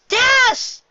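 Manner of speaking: very high, loud happy cry; cheer. Acted. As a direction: greet a friend gladly. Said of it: "Twilight cheered".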